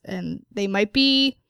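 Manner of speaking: normal talking voice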